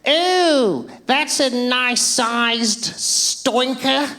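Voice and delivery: high, slow put-on woman's voice with a laugh; chilling compliment. Woman in the hedge complimenting him, high slow creepy coo. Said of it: "the most chilling compliment".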